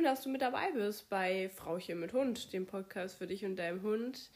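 ordinary talk, nothing marked

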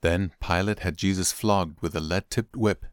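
plain reading voice

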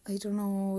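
normal talking voice

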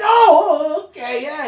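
high whiny mock-crying voice; mock whimpering. Mocking the gun owner turned meek, high whiny whimper. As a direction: whimper like a scared tough guy.

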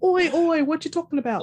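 mock Cockney, high and loud; bad British accent. Teasing an actress's British accent, hammed-up mock London voice. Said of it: "get better at her British accent".

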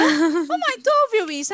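agudo, meio rindo de nervoso; imitando o susto. Retold or imitated. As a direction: imita o próprio susto chamando a mãe. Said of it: "Eu fiz". (chamando a mãe, susto imitado)

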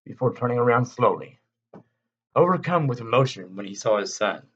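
ordinary delivery

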